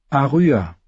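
put-on a girl finally admitting her name.

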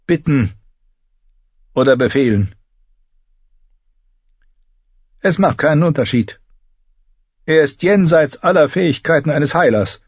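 high, forceful character voice, dismissive; blunt healer woman Miri. A narrator's reading. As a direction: deliver a hopeless verdict as the healer.

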